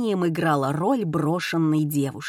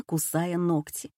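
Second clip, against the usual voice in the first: ordinary delivery, nothing marked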